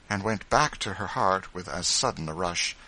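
ordinary delivery

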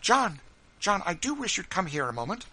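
high, urgent wifely call; quick, earnest. Acted. Wife calling husband from the door, earnest. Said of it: "in a quick, earnest tone".

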